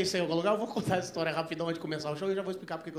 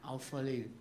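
ordinary talk

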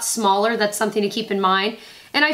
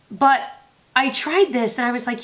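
normal talking voice

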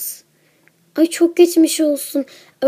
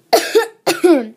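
a single forced cough; acted cough; 3 semitones higher, 5 dB louder than usual. Sick girl coughing in front of her friend, acted cough. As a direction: fake a cough.

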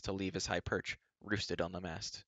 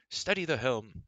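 cheery order called to the crew, a little higher; happy shout; 4 semitones higher, 4 dB louder than usual. A narrator's reading. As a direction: call out a jolly command. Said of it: "Ron shouted happily".

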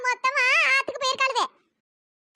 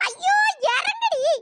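high, slow wailing yell of pain; crushed mother-in-law wailing get off; crying shout; 7 semitones higher, much slower than usual. Acted. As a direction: cry and shout at once to get off. Pinned, in pain.

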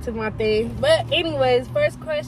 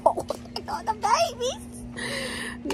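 cooing; 9 semitones higher, 7 dB quieter, much slower than usual. Real, not acted. Cooing over puppies.

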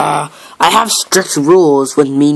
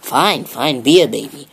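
normal talking voice

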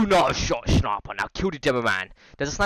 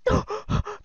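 sharp gasp. Real, not acted. About to be killed.

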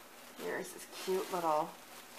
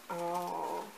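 ordinary talk

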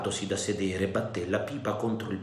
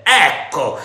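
a louder angry cry; shouted; 3 semitones higher, 9 dB louder than usual. A narrator's reading. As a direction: throw it out like a curse. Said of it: "gridò".

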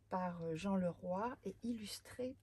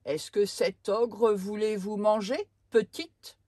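stern soldier; 14 dB louder than usual. Acted. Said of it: "demande un soldat".

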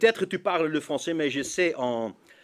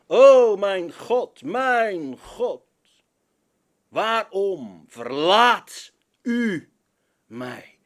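slow, drawn-out cry, fading on "mij"; slow anguished cry; 3 semitones higher, 4 dB louder, much slower than usual. Acted. Preacher acting Jesus' cry, slow and dragged out. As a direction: stretch "O mijn God" out slowly, sinking at the end. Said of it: "niet anders lezen dan met heel veel nadruk".

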